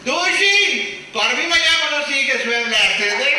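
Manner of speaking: very high, loud nagging voice; hammed-up wife scolding; shrill mock wife. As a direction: screech it like an angry wife.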